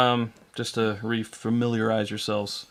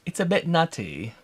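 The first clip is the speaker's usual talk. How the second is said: higher-pitched, amateur British spy impression; Austin Powers; 7 semitones higher than usual. Host performs a viewer's requested catchphrase, attempted British impression. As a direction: have a playful go at an English catchphrase accent. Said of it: "in your best Austin Powers accent".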